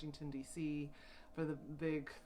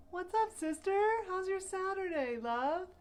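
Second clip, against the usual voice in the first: high, loud, affectionate hello; excited greeting; 7 dB louder than usual. Real, not acted. Host welcoming a friend to the livestream, excited high greeting. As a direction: greet her high and full of delight. Greeting a viewer who joined.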